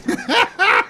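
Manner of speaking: short, high chuckle; quick high-pitched burst of laughter; amused laugh. Acted. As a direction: laugh at a friend's old mishap. Laughing at friend's romantic mishap.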